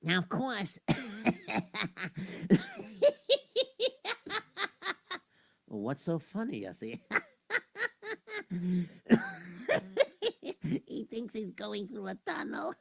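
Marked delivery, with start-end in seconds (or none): 0.8-5.2 loud laughing fit. Real, not acted. Narrator cracks up at the story. 7.5-10.8 helpless high laughter. Real, not acted. Narrator keeps laughing hard.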